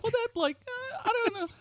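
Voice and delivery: high, wavering put-on voice; a hint of a torn couch shopper; mock undecided viewer. Host voices himself watching an infomercial, high hesitant mock voice. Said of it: "just like".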